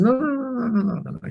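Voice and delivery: high, softening run of no's; mock dismissive protest. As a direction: imitate someone waving off a misunderstanding, pitched high. Co-founder brushes off his objection.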